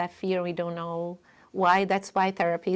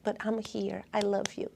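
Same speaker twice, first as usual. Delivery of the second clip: tender reassurance; 8 dB quieter than usual. Shown as a demonstration.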